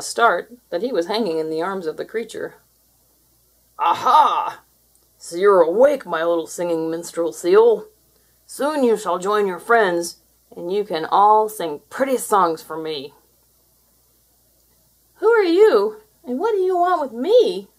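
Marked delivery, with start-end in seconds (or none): 3.8-13.1 loud, slow, menacing monster voice; gloating creature. Acted. Creature holding seal, loud slow gloating. 15.2-17.7 high, loud, scared little voice; frightened seal. Acted. Captured seal crying out to the creature. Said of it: "Trapper cried".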